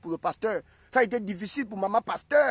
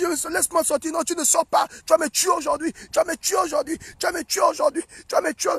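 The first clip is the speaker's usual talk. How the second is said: higher-pitched, insistent mock pleading; acted couple's quarrel; 5 semitones higher than usual. Pastor's wife fighting to get out, high mock protest. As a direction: act out a heated marital row, repeating in a high voice.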